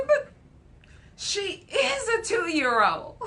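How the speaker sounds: high, laughing plea on the verge of tears; laugh-cry defending the toddler; tearful laughter; laughing protest. Retold or imitated. Retelling own protest, laughing.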